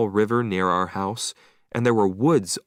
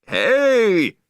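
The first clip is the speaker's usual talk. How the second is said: loud, high angry yell; angry shout; 12 semitones higher, 7 dB louder than usual. Acted. Beachgoer shouting at the owner, loud angry yell. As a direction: bark out a furious hey. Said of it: "somebody shouted angrily".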